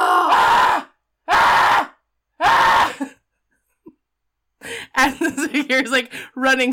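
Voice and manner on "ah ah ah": loud, high mock screams repeating; imitated echoing scream. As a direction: scream it three times like a tunnel echo. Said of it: "And of course, that is echoing".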